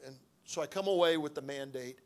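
ordinary talk, nothing marked

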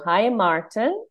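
ordinary talk, nothing marked

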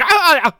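sharp high loud yelp of fright; imitated startled scream. Kid jumping up at the scare, yelping high and loud. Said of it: "jumped up, like".

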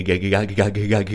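ordinary talk, nothing marked

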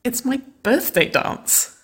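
high, slow, embarrassed confession; sheepish. A narrator's reading. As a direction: admit it bashfully, caught in the act. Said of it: "she grinned sheepishly".

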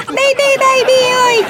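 put-on high, pouty cry for baby; cutesy whining call. Girlfriend calls her boyfriend to deal with harasser, cutesy whine.

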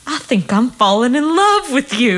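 high, hammed-up declaration of love; imitated patient confession. Patient confessing to his annoyed nurse, high mock-lovestruck delivery. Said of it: "He goes".

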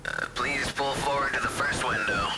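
drive thru speaker. Acted.

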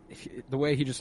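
ordinary delivery

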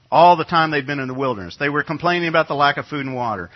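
ordinary talk

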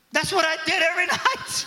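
high, loud laughing thought; mock exasperated. Preacher thinking of his own nightly preaching, laughing exasperation. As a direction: cry out in disbelief. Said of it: "I thought".

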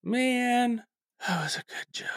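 soft, disappointed aside; deflated. Real, not acted. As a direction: lament a joke that got no reaction. Joke met by frozen silence.

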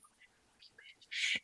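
a breathy whispered jab, barely voiced; whispered insult. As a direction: hiss it under your breath like a secret. Catty aside after being snubbed.

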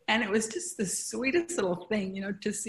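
fighting back tears as she speaks; getting emotional. Real, not acted. Mother telling how her young son stood up for her, choked up. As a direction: voice rising and choking up with emotion. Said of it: "I don't know why I'm getting emotional now".

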